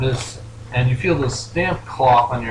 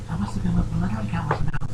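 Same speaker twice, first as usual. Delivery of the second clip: quiet, higher pony voice, quick; understated, small high character voice; Fluttershy; 6 semitones higher, 4 dB quieter, faster than usual. Acted. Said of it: "She nods quietly and goes".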